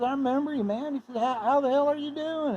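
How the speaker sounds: high, hearty imitated greeting; hearty mock greeting. Mike greets the classmate back.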